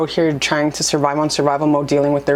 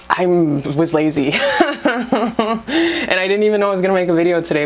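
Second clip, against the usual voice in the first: laughing; 3 semitones higher than usual. Real, not acted. Admitting to viewers she was lazy, laughing. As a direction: chuckle while owning up.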